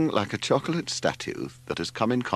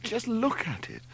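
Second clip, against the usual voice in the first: moaning; 4 dB quieter than usual. A narrator's reading. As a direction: lament it in a high moan. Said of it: "moaned Leslie".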